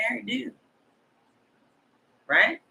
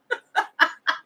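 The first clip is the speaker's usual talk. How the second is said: breathy, high laugh; real laugh; 9 semitones higher than usual. Real, not acted. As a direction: laugh at your own retort. Laughs at own retort.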